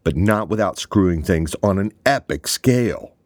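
furious shout, loud and high. A narrator's reading. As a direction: blow up and shout at him. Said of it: "Draper uncharacteristically shouted".

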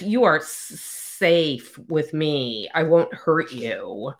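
slow, with the S of "safe" held long; snake-like S's. Shown as a demonstration. Snake alien reassuring Gemma, long S on "safe". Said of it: "a lot of their S's are".

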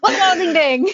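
loud, high-pitched goofy yell; silly catchphrase shout. Pretend. Podcast hosts goofing around, silly shouting. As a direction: belt it out like a cartoon catchphrase.

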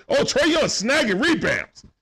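high, drawn-out commentator's cheer; real thrill, stretched out slowly; excited play-by-play. Real, not acted. Live call of a rebound.

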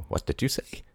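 whispered in disbelief; stunned whisper. A narrator's reading. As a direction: breathe it in shock. Shocked whisper.

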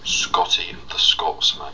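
slow, breathy Scottish whisper; drawn-out Scottish murmur; Scottish character voice. Acted. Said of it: "as a Scottish man called Scotty".

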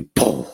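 low-pitched explosive "pum"; imitated explosion. Trainer describing the dog's sudden change, imitated bang. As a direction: drop in a verbal boom.

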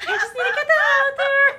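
high, loud, squeezed out through laughter; helplessly cracking up; laughing confession. Real, not acted. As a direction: talk through a giggling fit. Confessing amid a laughing fit.

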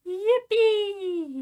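high loud squeal of joy; excited squeal. Acted. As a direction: squeal it out gleefully. Sudden cheer in banter.

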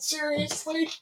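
high, disbelieving "Seriously?"; surprised. Retold or imitated. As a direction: react in disbelief. Told rangers watched them.

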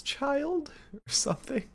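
high puzzled squeak of a question; high mock confusion. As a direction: squeak it up, playing yourself confused. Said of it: "I was like".

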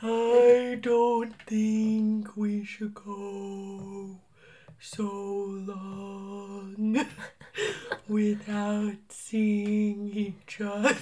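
very slow, stretched whale voice. Pretend. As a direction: drawl each word like a whale. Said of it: "whale voices, always".

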